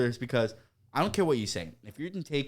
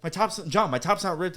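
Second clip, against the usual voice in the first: higher imitation of upset coworker; mock indignant complaint; 7 semitones higher, 4 dB louder than usual. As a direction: protest in a raised voice.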